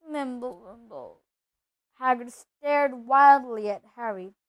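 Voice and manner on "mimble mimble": hushed and fading away; whispered. A narrator's reading. Pale Uncle Vernon reacts to Hagrid's shout, whispering. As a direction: let it shrink to almost nothing. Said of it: "whispered something that sounded like".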